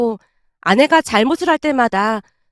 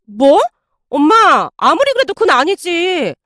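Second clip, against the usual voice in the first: shocked protest; 4 semitones higher than usual. Acted. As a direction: object in alarm to a mother. Wife protests mother's threat in quarrel.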